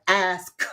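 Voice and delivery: "ask" with a distinct K released at the end; pronounced K. Shown as a demonstration. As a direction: land hard on the final K of "ask". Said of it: "with the K at the end".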